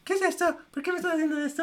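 niño asustado. Retold or imitated. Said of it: "Yo a los siete años".

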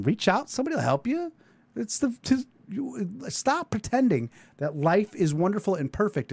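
high, pleading urgency; impassioned plea. Real, not acted. Urging listeners with drinking problems to get help, high earnest plea. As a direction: reach out to them, voice lifted high.

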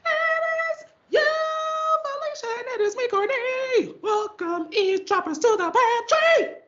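very high, bright sing-song welcome; high-pitched greeting. Acted. High sung show greeting.

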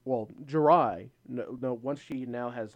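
ordinary delivery

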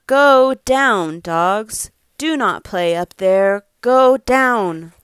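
loud, firm storybook order; commanding. Acted. Parent reads orders to playing dogs, loud command. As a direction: call out orders with punched words.

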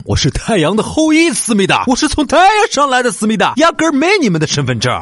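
put-on high, hammy Korean-accented boast; mock Korean accent. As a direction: act like a Korean drama star. Joking Korean drama parody.

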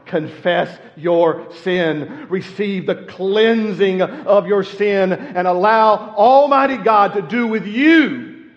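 slow appeal swelling into loud preaching; building pulpit appeal. Acted. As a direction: call the listeners to confess.

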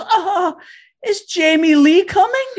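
put-on very high, eager older woman; excited mom. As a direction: ask all hopeful and thrilled. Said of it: "and she goes".

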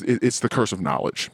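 ordinary talk, nothing marked

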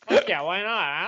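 normal talking voice

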